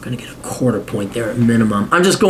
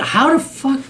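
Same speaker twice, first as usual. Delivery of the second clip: stunned disbelief; 12 semitones higher than usual. Real, not acted. Shocked reaction to Musk's globetrotting family.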